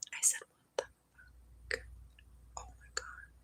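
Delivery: stunned, voiceless whisper; shocked whisper. Retold or imitated. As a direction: breathe it out in disbelief, barely audible. Retold shock at coma news.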